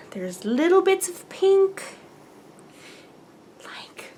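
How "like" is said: awed whisper. Real, not acted. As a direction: breathe it out in wonder. Awed by the yarn colours.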